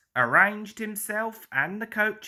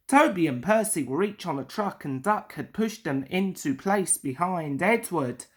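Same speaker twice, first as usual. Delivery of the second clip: ordinary delivery, nothing marked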